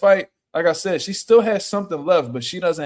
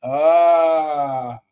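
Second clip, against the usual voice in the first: realizing exclamation; 5 dB louder than usual. Real, not acted. Learning about the failed test.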